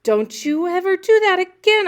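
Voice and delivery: breathless high shouted warning; breathless scolding. A narrator's reading. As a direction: scold him while still out of breath. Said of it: "I told Ilya, trying to catch my breath".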